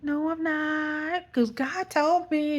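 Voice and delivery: high, drawn-out retort; acted-out cheeky certainty, slow and high; playful defiance. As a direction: stretch it out in a sing-song refusal. Acted defiant reply to staff.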